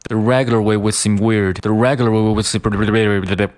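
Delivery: "The regular way would seem weird" run quickly together; fast weak "would". Shown as a demonstration. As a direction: rush through "would", barely there, d dropped. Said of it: "把这个would读得非常快". (快速连读弱读)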